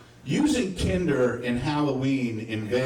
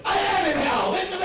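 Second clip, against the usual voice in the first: mock despairing shout; 4 dB louder than usual. Real, not acted. Comedian shouting his despair to the crowd, hammed-up. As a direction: shout it high and loud, hammed-up. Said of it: "shouts in mock despair".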